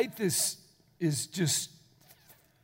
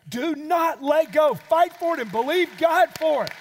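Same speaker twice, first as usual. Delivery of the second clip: shouted exhortation; 8 semitones higher, 7 dB louder than usual. Real, not acted. Preacher exhorts congregation after whisper.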